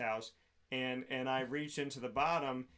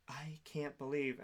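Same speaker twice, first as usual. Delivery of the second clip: slow, softer mock whisper; stunned hushed disbelief; 6 dB quieter, slower than usual. Wife looks at husband after thrown toast, aghast whisper. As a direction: imitate someone aghast, barely voiced.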